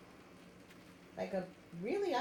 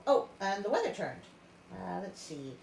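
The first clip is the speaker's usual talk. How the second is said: plain reading voice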